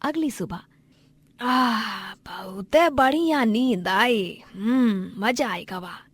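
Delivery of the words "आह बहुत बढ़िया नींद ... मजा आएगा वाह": slow, drawn-out contented sigh of speech; leisurely, blissful morning voice; village elder waking refreshed. Acted. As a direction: stretch and savour a good sleep.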